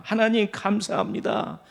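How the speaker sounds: ordinary talk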